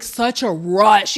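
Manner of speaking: normal talking voice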